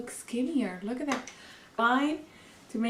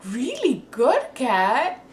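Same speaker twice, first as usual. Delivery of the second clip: laughing; 3 semitones higher, 8 dB louder, much slower than usual. Real, not acted. Admiring herself, laughing.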